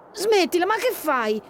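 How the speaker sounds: put-on irritated older brother; annoyed scold. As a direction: stop her right now. Brother stops dancing sister.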